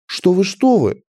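high, hasty protest; flustered fat merchant. A narrator's reading. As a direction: placate customers who are walking out. Merchant anxiously stops departing customers. (суетливый торговец, примирительно)

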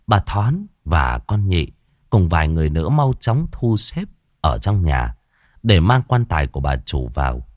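usual speaking voice

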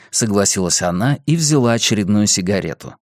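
usual speaking voice

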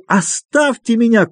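high, drawn-out angry cry; frenzied cry. A narrator's reading. Doctor losing control at his visitors, frenzied cry. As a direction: cry out as if beside yourself. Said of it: "крикнул он не своим голосом".

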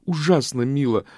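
eager exclamation; ardent cry. A narrator's reading. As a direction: burst out with enthusiasm. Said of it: "с жаром воскликнула".

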